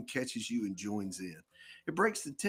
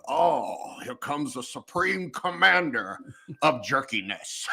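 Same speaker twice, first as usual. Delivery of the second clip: louder, slow pompous announcement; grand mock greeting; 3 semitones higher, 6 dB louder, slower than usual. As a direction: hail your friend like royalty. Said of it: "greeting your best friend with a".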